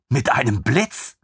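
lieutenant echoing in delight; amazed question. Acted. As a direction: repeat it in wide-eyed wonder. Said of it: "lieutenant repeats, amazed".

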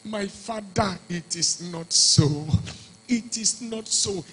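soft, slow pleading, a touch of tears; mild tearful plea. Acted. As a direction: quietly beg the father to stop. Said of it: "He began to cry".

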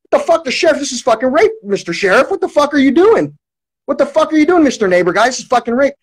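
high-pitched outraged protest, not full volume; retold screaming. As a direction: reenact confronting them, voice jumping up high. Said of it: "I just started screaming as loud".

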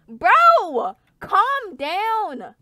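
loud, high "bro, calm down"; frustrated gamer outburst; exasperated yell. Real, not acted. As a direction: shout at them to back off. Pleading with attacking players.

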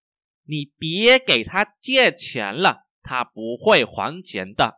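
slow, syllable-by-syllable model reading; slow, carefully enunciated Mandarin for learners. Shown as a demonstration. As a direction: read slowly so each tone is heard. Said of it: "mình đọc rất là chậm".

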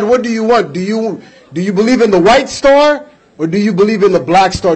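normal talking voice

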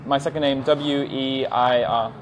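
slow, letter by letter; spelling out slowly. Shown as a demonstration. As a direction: spell the name slowly and clearly. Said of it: "could you spell your nick Facebook?".

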